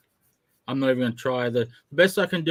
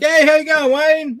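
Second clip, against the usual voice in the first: high, loud, over-the-top hello; exuberant greeting; 12 semitones higher, 9 dB louder than usual. Retold or imitated. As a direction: call out a hammed-up, carefree greeting. Acts out confident greeting to Wayne.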